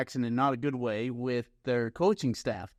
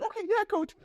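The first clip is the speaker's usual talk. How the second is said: much higher, timid squeaky reply; meek yes-coach. Retold or imitated. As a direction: cower and agree fast. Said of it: "And I was like".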